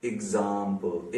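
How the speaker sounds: Example said slowly and softly, syllables drawn apart; slowed for syllables. Shown as a demonstration. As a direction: draw out each part of example. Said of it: "तीसरी तरफ हो गया". (Example को धीरे-धीरे, हर हिस्सा अलग)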